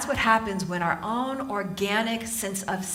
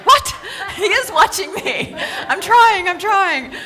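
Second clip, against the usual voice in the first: high, loud comic panicked outburst; mock panic; 10 semitones higher, 9 dB louder, slower than usual. Startled gym-goer thinks she's being watched, mock panic. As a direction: yelp it defensively, much higher and louder.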